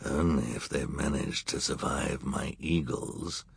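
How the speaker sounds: low, quiet menacing growl. A narrator's reading. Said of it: "Count Olaf said with a growl".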